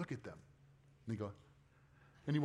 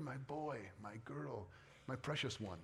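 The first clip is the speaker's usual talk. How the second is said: tender parent voice; 2 semitones lower, 9 dB quieter, slower than usual. Retold or imitated. Parent thinking of child.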